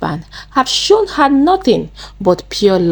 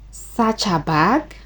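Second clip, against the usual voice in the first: slow, softer questioning with a rising end; surprised; 7 dB quieter, slower than usual. A narrator's reading. Amaka questions being told to search the girl's bag, surprised. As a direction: draw it out softly as a surprised question. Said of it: "Amaka said in surprise".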